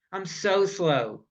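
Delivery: ordinary talk, nothing marked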